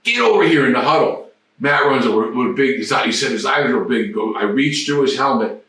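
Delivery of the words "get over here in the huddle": captain summoning teammate, calling out. Retold or imitated. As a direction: yell him into the huddle. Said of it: "he come over".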